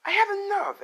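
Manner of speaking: acted fed-up outburst, high and loud. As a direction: raise pitch and volume sharply as the wife. Acted quarrel, wife fed up.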